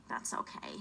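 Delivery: breathy whisper to herself; whispered aside. Real, not acted. Comedian whispering a rueful aside to the audience. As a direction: let it slip out under the breath. Aside after self-deprecating joke.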